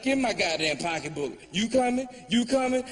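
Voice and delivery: acted huffy exit, rising in pitch; making a scene. Woman leaving show calls her friends, mock huffy scene. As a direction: play a woman storming out and rounding up friends. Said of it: "a scene of going".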